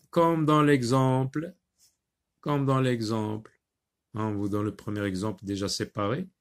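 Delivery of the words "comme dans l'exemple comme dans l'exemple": ordinary talk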